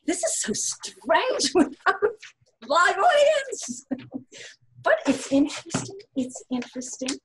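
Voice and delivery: real laughing exclamation; amused bewilderment. Real, not acted. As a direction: laugh at how odd it feels, voice leaping up. Awkward laugh at reading without audience.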